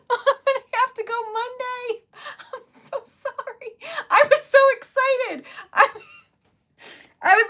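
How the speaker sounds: high-pitched, laughing dismay; genuinely flustered. Real, not acted. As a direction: wail the apology through helpless laughter. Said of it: "I got genuinely flustered".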